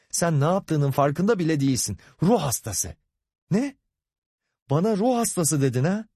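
tiz perdeden hakaret ve tepki; eşine tiz sesle öfkeyle yüklen; öfkeli suçlama. Acted. Hakaret ve şaşkın öfkeli karşılık. (kızgın, alıngan atışma)

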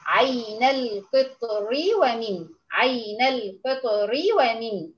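عين القطر ومن slow, ra of القطر light with kasra; connected reading demo. Shown as a demonstration. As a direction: read عين القطر ومن slowly, thin ra. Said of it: "لو وصلت ... هتبقى مرققة". (قراءة عين القطر ومن بالوصل وترقيق الراء ببطء)

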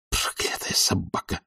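furious hissed whisper; hushed, grinding anger; gnashing teeth. A narrator's reading. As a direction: curse slowly under your breath. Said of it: "проскрежетал зубами Обломов".

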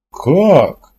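stunned "how?" with pitch jumping; taken aback. A narrator's reading. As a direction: blurt it out, dumbfounded. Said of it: "опешил Ордылов".

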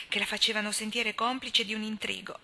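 plain reading voice

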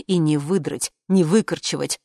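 usual speaking voice

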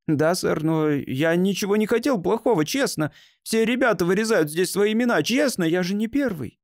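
put-on frightened boy. A narrator's reading. Boy caught carving his name answers a stranger, frightened. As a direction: protest honestly in a high, frightened boy's voice. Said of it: "вид у него был испуганный".

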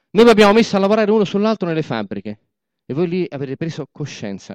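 high, loud speech to the crowd; booming speech from the podium; rally oration. Acted. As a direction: proclaim it like a political orator. Boss addresses comrades as rally.

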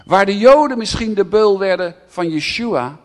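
loud, high, slow proclamation; preacher crying out to his church; shouting sermon. Acted. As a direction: hammer home the innocent suffering. Preaching at full force, shouting.

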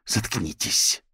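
spat-out venomous order. A narrator's reading. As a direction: cut them off with contempt. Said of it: "Молли брызнула ядом на них".

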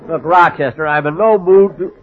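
ordinary talk

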